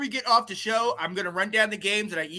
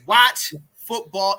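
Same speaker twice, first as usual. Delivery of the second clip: slow, punched, louder words; emphatic command; 7 dB louder, much slower than usual. Real, not acted. Host urging listeners, slow and emphatic. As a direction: drive it home slowly.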